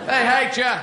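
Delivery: defiant announcement. Acted. Challenged mynah repeating it to the guests, high defiant blurt. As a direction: blurt it out for all to hear.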